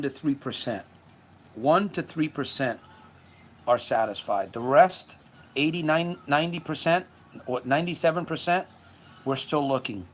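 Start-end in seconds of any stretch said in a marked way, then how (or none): none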